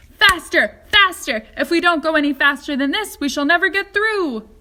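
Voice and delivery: loud, high, quick urging; frantic shouting; urgent cries. A narrator's reading. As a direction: egg the machine on, breathless. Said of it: "cried Mister Wonka".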